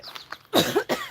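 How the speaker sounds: sudden choking cough; coughing on a swallowed bug. Real, not acted. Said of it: "I swallowed a bug a little bit".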